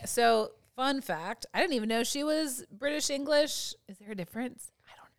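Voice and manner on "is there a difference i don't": hushed, whispered question; whispered aside. Real, not acted. Doubtful aside after admitting ignorance.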